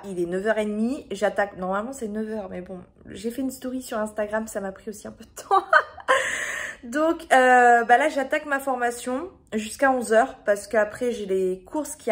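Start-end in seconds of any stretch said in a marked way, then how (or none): 5.7-6.8 short breathy burst of laughter; laughing. Real, not acted. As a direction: laugh it off, embarrassed. Laughs at own late start.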